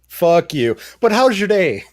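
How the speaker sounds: mock Canadian, polite after the insult; chirpy Canadian twist on a rude line; Canadian accent. Teasing a Canadian friend, mock Canadian accent. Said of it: "the most Canadian thing you've ever said".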